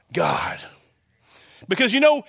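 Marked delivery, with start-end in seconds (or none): 0.1-0.6 hushed reveal. Acted. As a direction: whisper the word God, low and breathless. Dramatic reveal of who is attacked.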